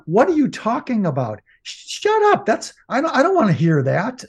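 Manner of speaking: put-on high, snapping parent voice brushing the kid off; mock angry parent. Parents snapping at a child who shares feelings, mock angry. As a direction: play the parent cutting the child off sharply. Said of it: "then the parents went".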